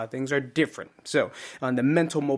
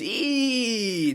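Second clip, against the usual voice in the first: 1 New Jersey Italian; 7 semitones higher than usual. Retold or imitated. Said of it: "I keep doing this".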